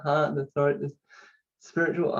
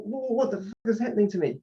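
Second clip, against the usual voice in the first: alarmed; 7 semitones higher, faster than usual. Retold or imitated. Retelling the shock of burning energy.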